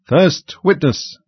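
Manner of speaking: slow, high herald's call. A narrator's reading. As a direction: draw out each word as a court announcement. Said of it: "called out".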